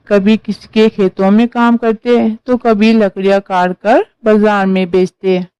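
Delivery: usual speaking voice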